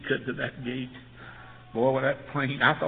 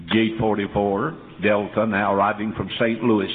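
booming, lower PA announcement voice; airport announcer; 4 semitones lower, 7 dB louder than usual. Retold or imitated. Pastor imitating the airport gate announcer, louder and lower. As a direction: call it out like the gate loudspeaker. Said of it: "every time he'd say".

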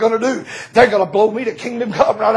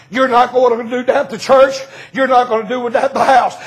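ordinary talk

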